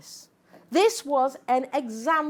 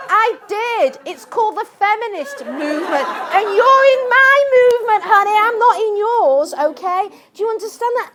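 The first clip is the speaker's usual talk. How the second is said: much higher and louder, laughing through it; loud triumphant retort; 9 semitones higher, 10 dB louder than usual. Retold or imitated. To the audience, replaying her on-air comeback, loud and laughing. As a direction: put her firmly in her place. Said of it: "So I said".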